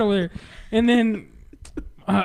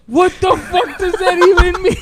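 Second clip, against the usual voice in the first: laughing disbelief; 9 semitones higher, 8 dB louder than usual. Retold or imitated. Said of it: "And I'm like".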